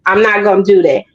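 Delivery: normal talking voice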